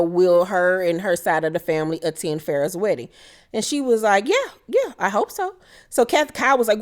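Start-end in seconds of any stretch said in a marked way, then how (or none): 4.3-5.5 high-pitched imitated reply; mock eager agreement. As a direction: answer lightly in a much higher voice. Retold answer to wedding question.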